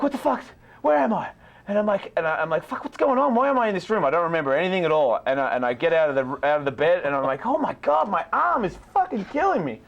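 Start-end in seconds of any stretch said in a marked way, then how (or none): 0.0-1.3 high, alarmed waking shout; half-mock panic, pitched way up; panicked confusion. 2.6-4.0 high, alarmed waking shout; half-mock panic, pitched way up; panicked confusion. 7.5-9.8 high, whining pain; hammed-up ache, voice pitched high; mock pained wail. Arm hurting after punch.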